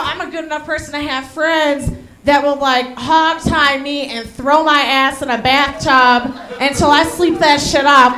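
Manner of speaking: normal talking voice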